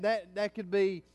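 usual speaking voice

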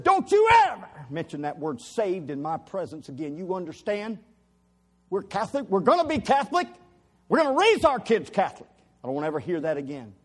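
loud, high, raging threat; furious shout. Retold or imitated. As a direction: shout him down with fury. Husband threatening his wife.

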